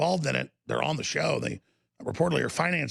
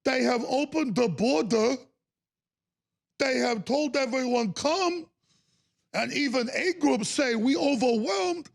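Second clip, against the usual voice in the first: mock broken English; 8 semitones higher than usual. Mocking migrants in broken English.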